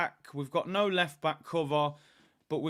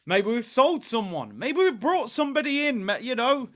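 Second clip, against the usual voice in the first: high, hopeful excitement; excited; 7 semitones higher, 5 dB louder than usual. Retold or imitated. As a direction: get your hopes right up. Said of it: "so I'm thinking".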